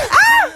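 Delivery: very high comic scream; hammed-up shriek; mock crash scream. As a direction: scream as the car hits. Pretend car crash.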